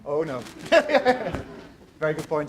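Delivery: usual speaking voice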